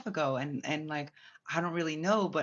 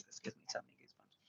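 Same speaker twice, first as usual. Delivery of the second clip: hushed whisper, much softer and quick; awed whisper; 16 dB quieter, faster than usual. Real, not acted. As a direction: breathe it out, spooked by the memory. Awed aside while telling eerie story.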